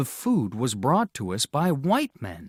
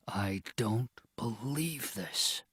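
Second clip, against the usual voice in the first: soft, slow, stunned murmur; quietly; 8 dB quieter, slower than usual. A narrator's reading. Father after the ice melts in his hand, quietly stunned. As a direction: barely voice the disbelief. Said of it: "Father said quietly".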